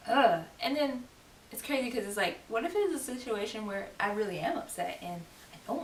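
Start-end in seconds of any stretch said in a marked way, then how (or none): 0.1-0.4 loud disgusted groan; sudden loud "ugh" of annoyance; exasperated ugh. Retold or imitated. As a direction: groan it out, fed up. Her exasperated reaction to nagging.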